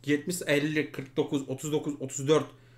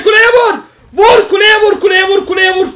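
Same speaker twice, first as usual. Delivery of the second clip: loud high yelling command; yelling at teammates to hit the tower; urgent shout; 12 semitones higher, 15 dB louder than usual. Real, not acted. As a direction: scream the order over and over. Screaming order to hit tower.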